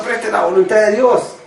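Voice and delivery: put-on a young man stubbornly defending himself to his pastor; stubborn insistence. Arguing with his pastor, insisting stubbornly. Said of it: "Yo le decía al pastor".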